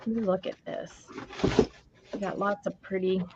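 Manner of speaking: ordinary talk, nothing marked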